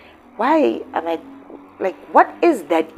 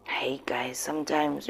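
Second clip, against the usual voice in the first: much softer whisper; 2 semitones lower, 11 dB quieter than usual. Real, not acted. Vlogger whispering after the mother's plea. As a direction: trail off into a sad whisper.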